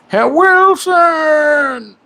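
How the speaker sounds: long, high drawn-out call; stretched-out high shout, Tom Hanks style; Castaway yell. Retold or imitated. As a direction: call across the island to a lost friend. Castaway quote, yelling to Wilson.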